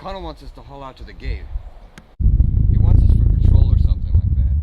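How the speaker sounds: shouting to wake them; urgent barked wake-up call; loud call. Acted. Waking soldiers for patrol.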